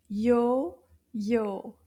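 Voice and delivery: "Yo-yo" split into two separate beats; syllable by syllable. Shown as a demonstration. Teacher counts two syllables for children, "Yo-yo" in separated beats. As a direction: count out "Yo-yo" with a gap between syllables. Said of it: "Deux syllabes".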